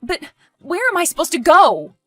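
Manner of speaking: loud, high plea; dismayed cry at being thrown out; distressed protest. Acted. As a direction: demand an answer in rising distress. Evicted daughter protests.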